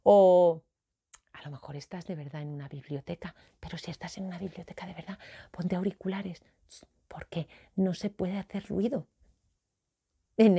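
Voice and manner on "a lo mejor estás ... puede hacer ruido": whispered, hushed library voice; susurrando. Pretend. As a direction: whisper it so nobody hears. Hablando de estar en biblioteca. (susurro de biblioteca)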